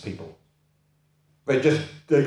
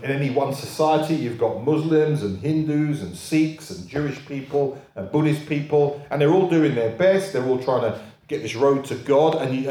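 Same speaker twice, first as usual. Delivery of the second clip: usual speaking voice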